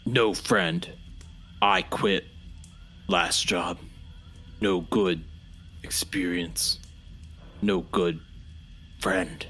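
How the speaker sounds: low, halting, broken speech; simple-minded Bobby. Acted. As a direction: say it like a slow brute. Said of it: "and Bobby says".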